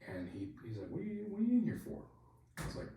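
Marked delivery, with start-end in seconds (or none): 0.9-2.0 high, fast, incredulous question; imitated disbelief. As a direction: play the baffled sergeant, voice shooting up. Sergeant surprised to find him there.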